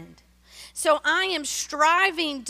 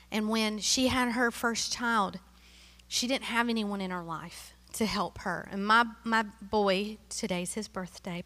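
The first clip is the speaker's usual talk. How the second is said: ordinary talk, nothing marked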